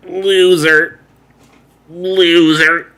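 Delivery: loud, high drawn-out creature call; booming underwater call; king jellyfish call. Retold or imitated. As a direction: bellow it like a sea monster summons. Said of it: "It goes".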